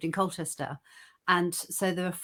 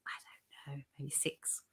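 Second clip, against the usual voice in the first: whisper; 5 semitones lower, 17 dB quieter, slower than usual. Real, not acted. Trailing aside, whispered.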